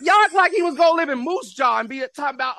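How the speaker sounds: loud, high-pitched outburst; incredulous high shouting; exasperated rant. Real, not acted. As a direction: shout down a silly idea. Ranting at teammates' gullible expectations.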